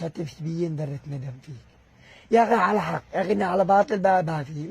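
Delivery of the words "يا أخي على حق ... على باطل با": loud, high indignant outcry; angry protest. Real, not acted. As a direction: cry out against the injustice. Protesting his unjust treatment. (احتجاج غاضب عالٍ)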